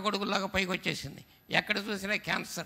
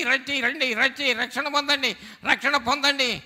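louder, higher gospel call; fervent preaching; 4 semitones higher, 5 dB louder than usual. Acted. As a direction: call the villages to salvation, loud and high. Preaching at full force.

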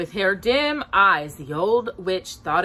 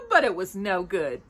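ordinary delivery

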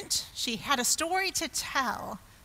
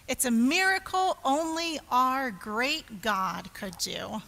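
ordinary talk

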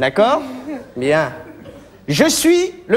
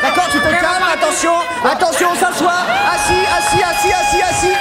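much higher, shrill panicked orders; frantic shouting; 7 semitones higher than usual. Acted. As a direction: yell them back into their seats. Therapist yells at unruly group.

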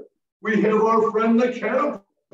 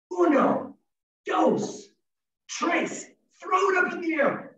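excited count; 9 semitones higher than usual. Shown as a demonstration. Counting to three for kids.

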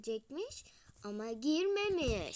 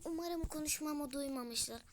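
ordinary delivery

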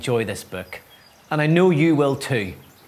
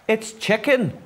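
higher-pitched call; a contained yell, pitched up; mild storybook yell; 8 semitones higher than usual. A narrator's reading. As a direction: call it out lightly. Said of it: "yelled Snail".

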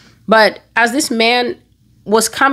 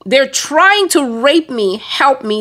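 high-pitched mock scream for help; urgent re-enacted scream, held below full volume; retold scream; 6 semitones higher, slower than usual. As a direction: cry the alarm out, pitch leaping up. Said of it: "I was screaming".

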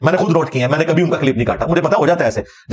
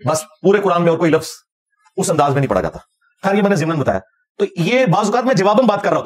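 ordinary delivery

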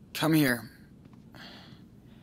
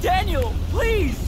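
very high, loud plea; desperate begging; 12 semitones higher, 6 dB louder, slower than usual. Acted. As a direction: cry out to him, begging. Brother begs over the uproar.

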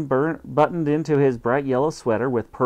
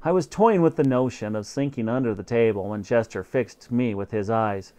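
usual speaking voice

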